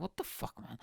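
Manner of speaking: whispered what-the-fuck; hushed exasperation; whispered disbelief. Retold or imitated. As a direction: breathe it out in disbelief. Retold reaction to early closing.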